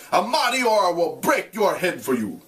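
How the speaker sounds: a hint of a harsh, threatening father; mild acted fury; swearing father. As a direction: swear at the son he scorns. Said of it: "he swore".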